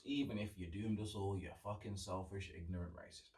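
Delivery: dropped almost to a whisper; soft, bitter muttered curses; muttered insult. Real, not acted. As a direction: hiss the insults under your breath. Cursing Brexit voters under breath.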